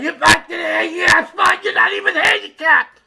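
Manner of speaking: very high, strained screechy yell; frantic yelling. Retold or imitated. Fallen man yelling from the ground, frantic high screech. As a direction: screech it at the top of your voice. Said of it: "He's yelling".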